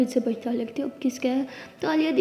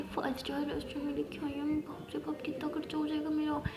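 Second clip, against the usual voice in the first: worried whisper; 3 semitones higher, 8 dB quieter than usual. Acted. Worried aside at expensive restaurant.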